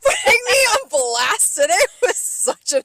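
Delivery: high, loud, laughing protest; playful loud complaint. Real, not acted. Server teasing co-host for exposing her, laughing outrage. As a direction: protest loudly through laughter.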